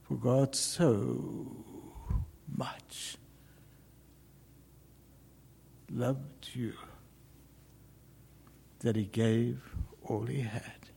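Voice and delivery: slow, soft, almost whispered; hushed gospel. Acted. Preacher quoting scripture to the church, hushed. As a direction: let each word fall softly into silence.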